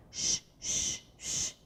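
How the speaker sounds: quiet voiceless Sh, sh, sh; sh sound. Shown as a demonstration. Teacher showing pupils the sound of C H, a soft sh. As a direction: hush out a soft sh. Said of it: "Que som é esse?".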